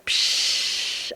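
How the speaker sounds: long voiceless whoosh of relief; imitated decompression. Traveler describing unwinding at home, imitated release of pressure. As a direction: let out a hushed "psh".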